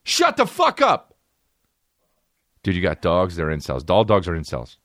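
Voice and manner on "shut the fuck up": high, drawn-out scolding shout; exasperated yell. Real, not acted. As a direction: snap at the dog at the top of your range. Yelling at his noisy dog.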